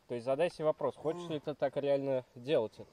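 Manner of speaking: ordinary talk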